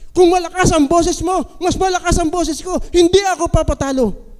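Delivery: high, loud quarrel voice; quarreling shout. Retold or imitated. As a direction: outshout them in an argument. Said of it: "yung sa away". (malakas na sigaw sa away)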